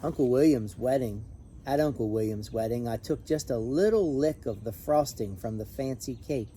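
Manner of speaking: usual speaking voice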